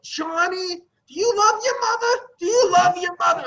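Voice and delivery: high, loud, wailing mother; guilt-tripping mother. Retold or imitated. Mother guilt-tripping her son on the phone, high wailing voice. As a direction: wail it like a guilt-tripping mom. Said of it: "she just laid the guilt on".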